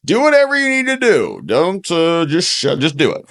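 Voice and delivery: high, put-on voice waving it all away; high hammed-up Lloyd Austin impression; Lloyd Austin playing dumb. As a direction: play a flustered official who doesn't want to know. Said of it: "Lloyd Austin's".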